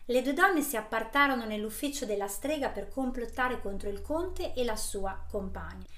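ordinary delivery, nothing marked

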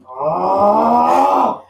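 sudden loud high shout. Real, not acted. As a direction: burst out with a yell.

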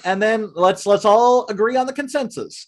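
ordinary talk, nothing marked

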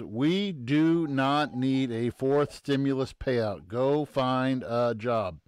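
slow, word-by-word reading; deliberate read-out. Real, not acted. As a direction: read the post out slowly, spacing every word. Reads out own Facebook post.

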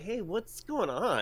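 friendly hello. Retold or imitated. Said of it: "So I'm like".

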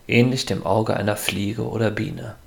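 ordinary delivery, nothing marked

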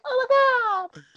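put-on crying kid. Kid overwhelmed as Vader saves Luke, teary high squeal. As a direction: squeak it out like a weeping five-year-old.

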